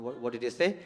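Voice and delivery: normal talking voice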